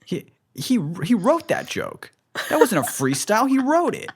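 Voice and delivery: high-pitched mock indignation; exposing a fraud, high and incredulous; outraged audience member. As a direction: squeal the accusation in a put-on high voice.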